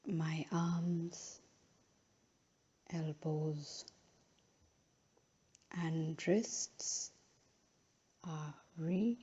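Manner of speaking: unhurried, measured phrases, each left to settle; guided relaxation. Real, not acted. Yoga teacher guiding a final relaxation in Shavasana, slow and calm.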